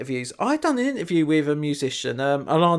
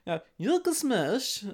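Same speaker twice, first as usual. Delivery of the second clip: Borat; 6 semitones higher, 5 dB quieter than usual. Acted. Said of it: "I could do something as bad as that".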